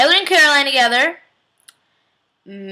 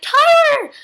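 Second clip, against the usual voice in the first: much higher, louder cry of "Tyler!"; mock scream of a name; 5 dB louder than usual. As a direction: shriek the name in alarm. Caroline cries out seeing Tyler.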